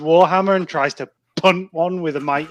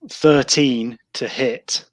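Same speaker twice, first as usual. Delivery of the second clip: ordinary talk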